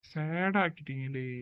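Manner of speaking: soft, slowed mock sulk. Complaining the background music turned sad, soft mock sulk. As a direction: drop into a deflated mock sulk. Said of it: "happy மூடுக்கு போடுங்கன்னா".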